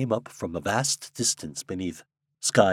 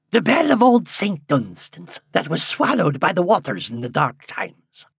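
put-on high, loud old countryman; old man voice; 9 semitones higher, 6 dB louder than usual. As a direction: speak as an excited old villager.

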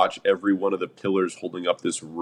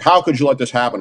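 imitating an irate caller, raised pitch and volume; higher, louder retold outburst, not a full scream; mild mock yell; 5 semitones higher, 5 dB louder, faster than usual. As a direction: play an upset caller, half-shouted. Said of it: "one guy screaming on the phone".

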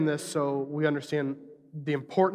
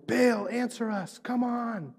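higher-pitched, drawn-out plea in imitation; mock crying out; 7 semitones higher, slower than usual. Prophets calling on Baal, mock crying out. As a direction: imitate a slow, high call without real volume. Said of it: "as they're crying out".

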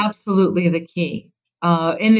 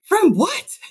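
alarmed question; 11 semitones higher than usual. Retold or imitated. Mom alarmed at son.

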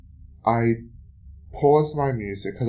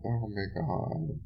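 quiet dismay; 8 dB quieter than usual. Real, not acted. Shaken after harassment.

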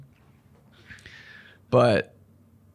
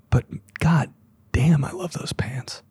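soft, whispery aside; acted whispered admiration; hushed admiration; 2 semitones higher, 5 dB quieter than usual. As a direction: murmur it as a secret confession. Acted onlooker admiring his outfit.

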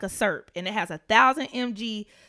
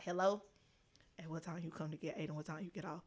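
much softer, lower, a voice on the line; caller on the phone; 5 semitones lower, 13 dB quieter than usual. Retold or imitated. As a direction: play the caller quietly asking when you'll pick up. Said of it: "then I get a phone call".

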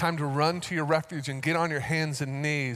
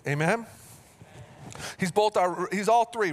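ordinary talk

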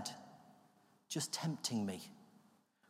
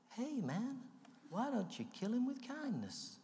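lady's voice in his head; 10 semitones higher, 6 dB quieter, slower than usual. Retold or imitated. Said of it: "this lady's voice".

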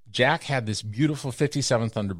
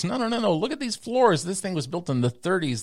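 much higher, hammed-up no no no; architect's mock refusal; 10 semitones higher than usual. Architect dismissing the building plan, high hammed-up refusal. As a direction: play the architect waving it off. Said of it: "And he goes".

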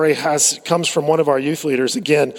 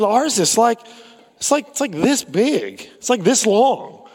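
higher, earnest retelling of the leader; imitating the youth leader; 6 semitones higher than usual. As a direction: play the leader showing how tiny it is. Youth leader describing short cord.

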